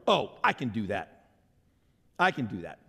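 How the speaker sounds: lightly voiced proud self-confidence, pitched low; prideful boast. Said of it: "we put too much pride in ourselves".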